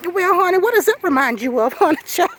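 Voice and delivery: put-on sassy sweetness; sassy honey voice. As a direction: play a sugary sassy auntie. Mocking sassy voice again.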